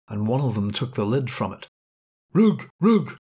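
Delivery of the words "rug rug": high, loud frantic barks of "Rug!"; terrified dog barking at garbage men; dog's cry. Acted. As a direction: yelp it out in horror. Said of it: "Boris cried".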